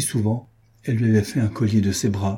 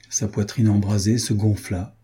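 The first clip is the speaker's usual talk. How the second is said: plain reading voice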